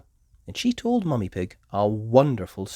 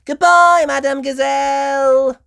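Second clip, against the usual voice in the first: loud, high sing-song goodbye; calling out; 14 dB louder than usual. A narrator's reading. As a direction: call it across the room like a class of kids. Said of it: "called the children".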